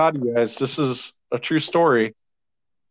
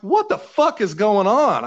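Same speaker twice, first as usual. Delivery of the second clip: mock disbelief; 8 semitones higher than usual. Baffled at being congratulated.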